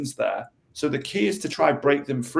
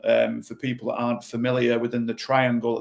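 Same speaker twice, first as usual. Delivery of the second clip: normal talking voice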